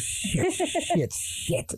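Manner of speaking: put-on high, soft child's muttered curse; little girl copying dad. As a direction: mimic dad's mutter in a kid's voice. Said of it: "the same mannerisms goes".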